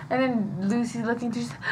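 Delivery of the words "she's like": usual speaking voice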